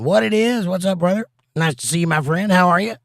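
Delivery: ordinary talk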